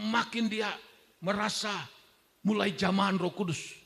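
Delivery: usual speaking voice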